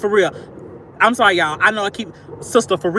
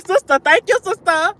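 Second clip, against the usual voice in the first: high excited calling out to sister; 11 semitones higher, 5 dB louder than usual. Real, not acted.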